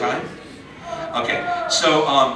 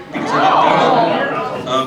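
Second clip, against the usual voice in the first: mock indignant; 11 semitones higher, 7 dB louder, much slower than usual. Joking protest when time is up.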